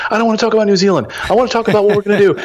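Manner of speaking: can't contain the big idea; telling his wife on the phone, giddy and rushing; bursting excitement. Retold or imitated. Excited call home to wife.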